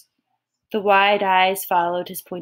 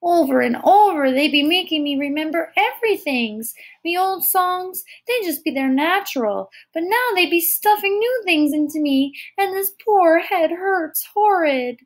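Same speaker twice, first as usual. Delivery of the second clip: put-on high, plaintive toddler complaint; unhappy small child; 8 semitones higher than usual. A narrator's reading. Tyke tells Kira her troubles, high unhappy child's voice. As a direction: complain like an overworked little one. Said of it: "Joe nodded unhappily".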